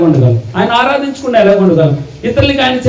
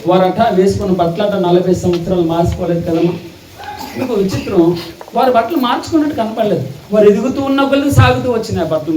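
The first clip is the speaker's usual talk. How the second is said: ordinary delivery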